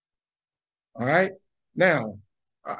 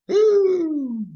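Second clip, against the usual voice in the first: a high ooh, louder than her talk; 4 dB louder than usual. Real, not acted. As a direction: cry out a thrilled ooh. Listener reacting with delight.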